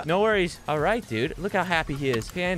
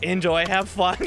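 mock tilted send-off, raised pitch; imitating mild irritation; 4 semitones higher, 3 dB louder, slower than usual. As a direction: mimic someone a little put out. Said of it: "that guy sounded a little tilted".

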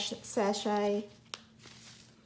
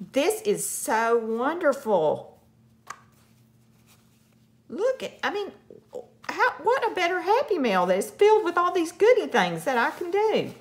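loud, soaring delight; real bubbling-over excitement; excited gushing; 8 semitones higher, 5 dB louder than usual. Real, not acted. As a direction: gush at a high pitch, thrilled.

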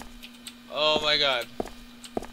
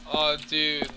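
ordinary talk

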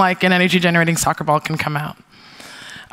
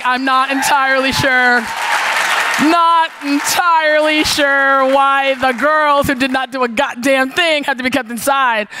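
higher, slow, drawn-out indignant objection; pointed indignation; 5 semitones higher than usual. Retold or imitated. Protesting to camp staff, slow pointed indignation. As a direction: stretch each word in pointed disbelief.

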